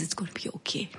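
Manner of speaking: very soft, hushed reassurance; soothing mother. Retold or imitated. Mother holding her crying daughter tight, hushed and soothing. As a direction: comfort a crying child, barely above a murmur.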